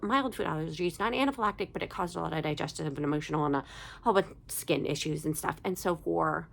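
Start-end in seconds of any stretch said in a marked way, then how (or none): none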